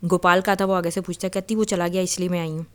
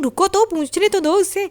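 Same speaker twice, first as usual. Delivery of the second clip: much higher, pleading protest; urgent protest; 13 semitones higher than usual. Retold or imitated. Maya to Badr dragging her away, much higher urgent plea. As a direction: pull back and beg him to wait.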